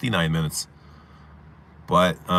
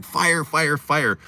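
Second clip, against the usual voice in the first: higher, lightly yelled fire; retold yelling; 8 semitones higher, much slower than usual. Kid running down the street calling fire, high mock yell. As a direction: call fire while running. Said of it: "running down the street going".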